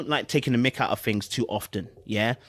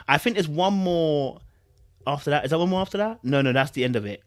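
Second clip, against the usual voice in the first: ordinary delivery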